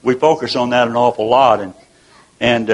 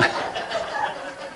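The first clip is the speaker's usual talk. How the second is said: real laugh; 11 dB quieter than usual. Real, not acted. Laughing at grandson's joke.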